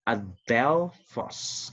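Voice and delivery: adelphos drawn out very slowly, syllable by syllable; teacher modelling pronunciation slowly; slow Greek demo. Shown as a demonstration. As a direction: spell out adelphos slowly for beginners. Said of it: "Next word is".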